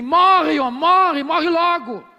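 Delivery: loud, high, scornful cry of morre; wife yelling curse. Retold or imitated. Wife to sick Job, a loud scornful yell. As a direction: hurl it at the sick husband.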